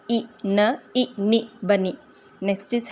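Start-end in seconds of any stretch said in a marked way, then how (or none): 0.1-1.5 ई, न, ई, नी as separate sounds; sounded out. Shown as a demonstration. Teacher blending the end of bunny, separated sounds. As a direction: say ई, न, ई, नी one at a time. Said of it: "ये sound निकालेगा ई".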